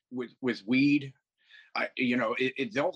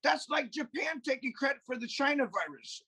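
pitched higher, ranting hard; worked-up rant; 9 semitones higher than usual. Real, not acted. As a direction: get fired up and push the voice higher. Heated rant about Germans.

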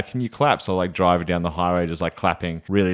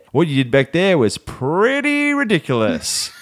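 pointed retort; 8 semitones higher, slower than usual. Retold or imitated. Telling off the rude Mercedes driver, slow and cutting.

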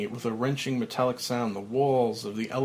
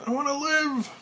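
desperate shout, higher. Acted. Buried alive, pleading.

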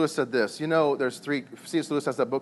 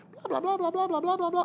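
squeaky high-pitched blah blah; mock the lady rambling in a tiny high voice; high blah-blah chatter. Husband mocks a chatty lady with squeaky high blah-blah. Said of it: "that lady says".

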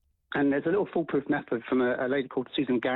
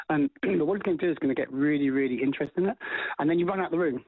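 ordinary talk

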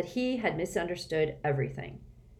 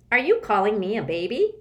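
growling; 4 semitones higher, 7 dB louder than usual. A narrator's reading. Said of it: "growled the other".

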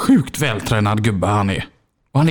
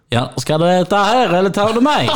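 high, laughing imitated cashier; mock Skåne cashier voice; McDonald's cashier; 6 semitones higher than usual. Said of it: "går in på McDonalds och säger".

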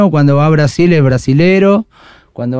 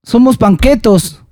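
slow, higher mock punk voice; imitating the punk; 7 semitones higher than usual. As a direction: quote the punk with a grin. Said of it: "¿cómo es que dice?".

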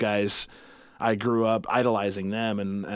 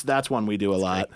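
usual speaking voice

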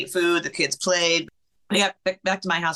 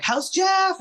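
sister's question. Retold or imitated. Voicing sister's morning question.

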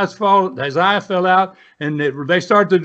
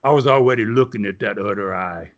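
put-on low, gravelly-free growl of a tough old wrestler, much lower; deep deadpan threat from a hard old man; gruff old wrestler voice; 10 semitones lower than usual. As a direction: drop way down as the menacing old wrestler. Dutch voiced in retold story.

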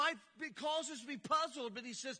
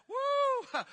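high whoop; 13 semitones higher, 9 dB louder than usual. Real, not acted. Laughing whoop.

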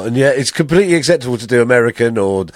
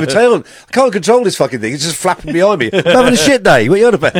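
high, indignant dog voice; comic dog rant, pitched up; fed-up dog; 4 semitones higher than usual. Acted. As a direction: protest as a put-upon dog. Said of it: "Dog's going".

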